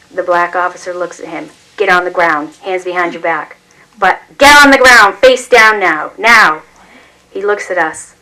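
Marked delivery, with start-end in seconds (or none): 4.0-6.6 higher, much louder barking command; shouted police order. A narrator's reading. Police officer shouting at father to lie face down, loud bark. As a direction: bark the order, losing patience.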